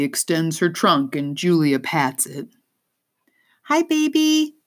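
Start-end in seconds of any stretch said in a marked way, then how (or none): 3.6-4.6 put-on high, sweet girl's voice; young girl voice. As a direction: coo to a baby animal. Girl greeting elephant sweetly.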